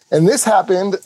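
ordinary talk, nothing marked

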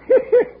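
high cackling chuckle; a proud old-timer's cackle; cackling laugh. Acted.

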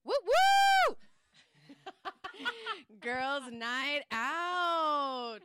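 squealing high and loud. Real, not acted. Cheering night out.

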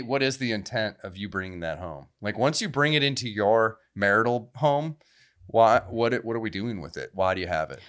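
ordinary talk, nothing marked

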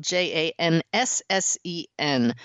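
J A N S S E N said letter by letter; spelling it out. Shown as a demonstration. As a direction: spell out J A N S S E N one letter at a time. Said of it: "last name is spelled".

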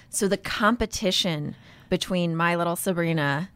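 usual speaking voice

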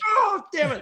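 high, loud mock cry of frustration; frustrated cry. Retelling his reaction to the failed video, high frustrated yelp. Pressed wrong camera button.

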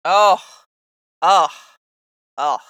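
loud excited gasps of delight; overwhelmed little cries of joy; awkward joy. Real, not acted. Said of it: "Sorry, I'm just so excited".

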